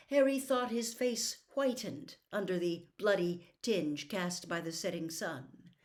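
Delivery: plain reading voice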